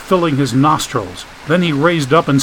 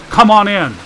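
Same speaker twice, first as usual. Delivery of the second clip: raised, higher-pitched call; 7 semitones higher, 5 dB louder than usual. A narrator's reading. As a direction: call them in from across the hall. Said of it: "Dagan called out".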